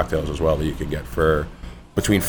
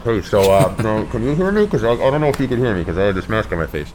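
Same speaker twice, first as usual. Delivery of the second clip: higher, fast, straining through a mask; through a face mask; 4 semitones higher than usual. Retold or imitated. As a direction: act out talking through a mask. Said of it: "without being like".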